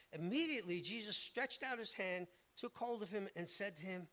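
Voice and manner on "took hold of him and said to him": ordinary talk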